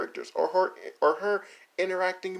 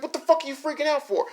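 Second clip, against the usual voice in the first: higher, louder and fast, fed-up disbelief; exasperated retort; 10 semitones higher, 5 dB louder, faster than usual. Retold or imitated. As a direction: fire it back, annoyed. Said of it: "And then I was like".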